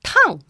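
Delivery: "tang" high and falling sharply; short, loud falling "tang"; fourth tone. Shown as a demonstration. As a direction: drop "tang" abruptly from the top. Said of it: "And the fourth tone".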